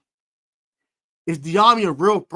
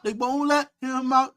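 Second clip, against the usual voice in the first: usual speaking voice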